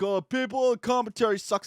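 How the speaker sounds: high, loud whine imitating critics; mock complaining. Imitating people who say commentary sucks, mock complaining. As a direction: mimic grumblers in an exaggerated high voice.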